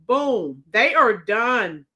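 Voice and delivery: ordinary talk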